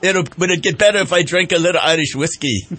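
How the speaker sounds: hammed-up Irish brogue, loud and lilting; a jolly Irish radio host's mock brogue. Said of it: "and my little Irish brogue here".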